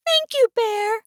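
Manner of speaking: high, soft, grateful little voice; soft mouse. A narrator's reading. Mouse thanks Bear for the telescope, soft voice. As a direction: thank tenderly as a tiny mouse. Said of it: "Mouse said softly".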